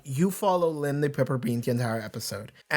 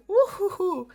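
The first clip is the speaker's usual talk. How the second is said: high giggly woo-hoo-hoo, much higher pitch; chuckling reaction. Retold or imitated. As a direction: laugh it out in a high, delighted chuckle. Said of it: "kinda chuckled to myself".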